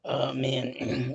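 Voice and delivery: ordinary talk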